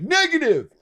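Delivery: hammed-up defiant soldier; mock soldier shout. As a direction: bark it back defiantly. Keeps replying in soldier role.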